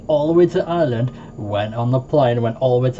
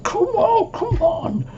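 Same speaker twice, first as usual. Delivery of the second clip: pleading high whine; slower than usual. Retold or imitated. Child begging ghosts to appear, very high whine. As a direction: beg the ghost to show itself, squeaky. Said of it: "And I was like".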